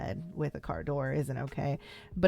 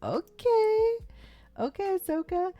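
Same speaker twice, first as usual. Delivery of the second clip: much higher and louder, gushing; impressed approval; 4 dB louder than usual. Retold or imitated. Viewer reacting to Ahsoka looking beautiful, high excited squeal. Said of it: "I was like".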